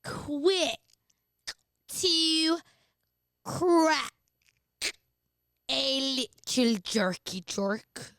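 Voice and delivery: slow duck voice with the mouth propped open; Donald Duck voice. Shown as a demonstration. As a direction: quack it out slowly like Donald Duck. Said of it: "being fucking half Donald Duck".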